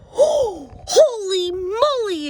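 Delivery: high, slow, amazed exclamation; exclaiming. A narrator's reading. Kitten amazed at what he sees, high and drawn out. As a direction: gasp it out in wonder. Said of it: "exclaimed Skippy John Jones".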